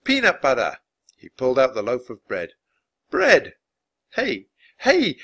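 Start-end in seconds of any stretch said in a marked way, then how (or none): 0.0-0.8 louder, higher exclamation; presenting eagerly. A narrator's reading. Showing food to the warrior. 3.1-5.2 loud, high, excited bursts; eager exclaiming. A narrator's reading. Offering gifts to wary warrior.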